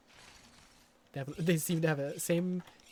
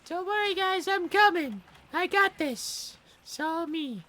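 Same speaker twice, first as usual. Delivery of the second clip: mock heroic bravado; 12 semitones higher, 5 dB louder than usual. Boastful hero act.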